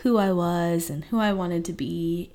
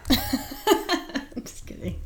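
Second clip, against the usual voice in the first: laughing; 6 semitones higher, much slower than usual. Real, not acted. Teasing husband after "mostly mom.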